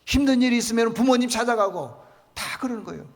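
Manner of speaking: a pastor exhorting the congregation loudly; fiery preaching. Acted. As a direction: drive the point home to the congregation. Preaching at full force.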